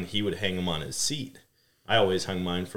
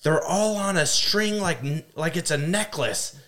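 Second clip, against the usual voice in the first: high, slow, bewildered mother voice; frantic mom; 10 semitones higher, 4 dB louder than usual. Retold or imitated. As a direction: puzzle over the strange necklace, pitched high. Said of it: "And she's like".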